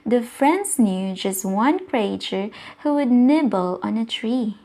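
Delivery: plain reading voice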